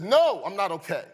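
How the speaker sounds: loud, high cry of pain; pained yell. Retold or imitated. Boy yells up at his sister from under the car, pained. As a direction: yell it out, foot still trapped under the tyre. Kid's foot run over by car.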